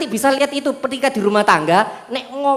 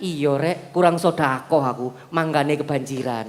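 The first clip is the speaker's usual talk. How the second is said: lower, softer resigned murmur; accepting fate; 9 semitones lower, 7 dB quieter than usual. Retold or imitated. Preacher voices a flood victim accepting blame, soft resigned murmur. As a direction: quietly admit your own fault. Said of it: "Tapi kalau sedang hijrah".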